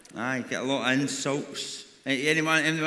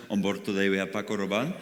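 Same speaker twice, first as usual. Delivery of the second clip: mock cabin crew; 8 semitones lower, 3 dB quieter than usual. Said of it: "they're just like".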